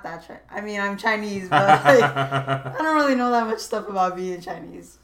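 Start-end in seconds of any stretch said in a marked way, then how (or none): 1.5-5.0 laughing. Real, not acted. Laughing admission about herself.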